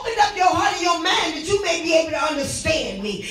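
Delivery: hollering revival preacher. Real, not acted. Preaching as God's voice, shouting.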